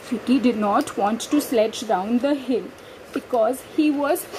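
ordinary delivery, nothing marked

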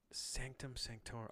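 hushed mystical whisper, slow. Acted. Savoring a mystic name for viewers, slow whisper. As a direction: breathe the name like a secret spell. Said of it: "savoring the mystic name".

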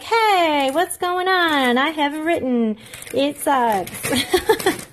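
high, drawn-out mock hello; mock casual greeting. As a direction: sing it out as a jokey announcement. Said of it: "pop on and be like".

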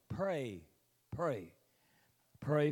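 usual speaking voice

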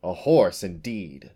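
scornful, loud "horse", falling off; snorted. A narrator's reading. Unicorn scorning the name she was given, snorting. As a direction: dismiss the insult with indignation. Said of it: "she snorted".

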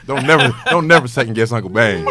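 shouted through laughter, cocky; loud laughing boast. Real, not acted. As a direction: crow it out, laughing hard. Boasting about being Uncle Bane.

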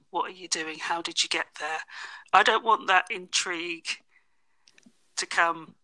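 ordinary delivery

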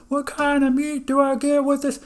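high, loud shouted question; yelling customer. Retold or imitated. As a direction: holler the question across to the counter. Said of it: "yelling across".

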